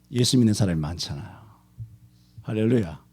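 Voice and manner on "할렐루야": 할렐루야 said low and soft, trailing down; soft hallelujah. Real, not acted. Preacher murmuring 할렐루야 to the congregation, soft and low. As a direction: murmur 할렐루야 quietly with a falling end. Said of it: "왜 아멘을 안 해?".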